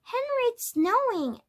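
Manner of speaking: high, singsong call to someone in the house; excited child calling. A narrator's reading. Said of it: "Heidi called".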